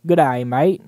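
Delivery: hammed-up Aussie greeting, badly faked; bad Australian accent. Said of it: "I'm shit at my Australian accents".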